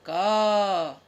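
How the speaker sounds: calls the letter out loud for the class. Shown as a demonstration. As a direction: ring the letter out so the kids repeat it. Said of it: "तो यहाँ पर हम लोग लिखेंगे".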